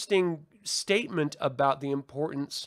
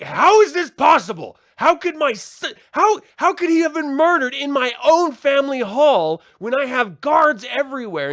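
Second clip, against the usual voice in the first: much higher, much louder, frantic outcry; freaking out; 12 semitones higher, 11 dB louder than usual. Retold or imitated. Grieving father shouting over his son's burned corpse, freaking out. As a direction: shout in disbelief over a dead son. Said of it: "he's, like, freaking out".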